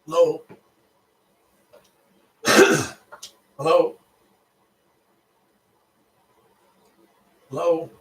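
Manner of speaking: loud, rising hellos into the phone; shouting into a phone. Real, not acted. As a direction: call out to check whether anyone's there. Nobody answers the phone call.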